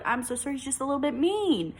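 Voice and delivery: hammed-up apology that turns into a brush-off; mock sassy apology. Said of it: "now I have to say".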